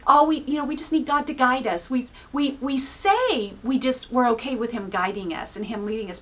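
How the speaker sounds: ordinary delivery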